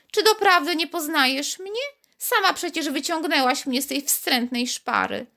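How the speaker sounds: high, reproachful little voice; mildly offended little voice. A narrator's reading. Bead reminds Karolcia who found it, mildly offended. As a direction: tease her for not knowing. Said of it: "lekkie oburzenie".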